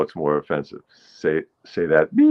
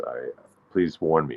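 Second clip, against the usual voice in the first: usual speaking voice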